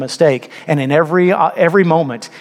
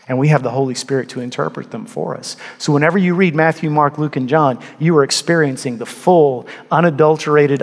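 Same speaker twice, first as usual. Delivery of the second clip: normal talking voice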